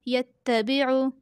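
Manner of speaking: ordinary delivery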